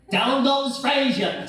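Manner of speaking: slow booming fight-announcer cry; boxing announcer call. Acted. Announcer-style knockdown call.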